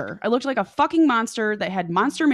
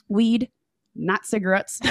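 usual speaking voice

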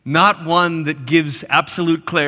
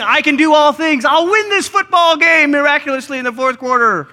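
higher, louder cocky boast; mock bravado; 10 semitones higher, 6 dB louder than usual. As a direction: brag like a prosperity preacher. Misreading of Paul mocked.

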